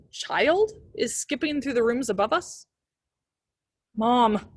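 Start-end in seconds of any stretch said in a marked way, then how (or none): 4.0-4.5 louder, pressing call to a sleeping mother; urgent call. A narrator's reading. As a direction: wake her up, this can't wait. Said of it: "I repeat more urgently this time".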